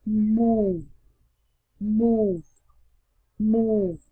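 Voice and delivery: "move" with long oo, ending on V; silent final E. Shown as a demonstration. Teacher showing the silent E, "move" long, ending in V. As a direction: stretch "move" and finish on the consonant V. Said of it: "Chúng ta sẽ đọc là".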